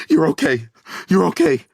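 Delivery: high, loud, tearful reassurance; father sobbing reassurance to daughter. A narrator's reading. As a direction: comfort her while crying. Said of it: "his shoulders shaking".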